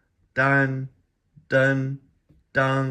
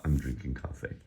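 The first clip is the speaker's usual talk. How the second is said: usual speaking voice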